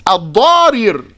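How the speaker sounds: "Al-Darir" with each r sounded separately; two ra's. Shown as a demonstration. Teacher showing the pattern underneath, "Al-Darir" with two separate ra's. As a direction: sound out both r's in "Al-Darir". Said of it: "with two ra's".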